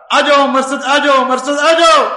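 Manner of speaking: woman calling again and again. Retold or imitated. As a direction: keep calling his name. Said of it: "اس نے بار بار پکارا".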